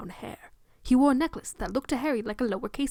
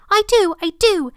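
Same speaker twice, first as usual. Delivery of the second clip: put-on much higher and louder, a delighted child's squeal; 10 semitones higher, 11 dB louder than usual. Boy eagerly answering the preacher, excited child squeal. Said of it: "Harry squealed".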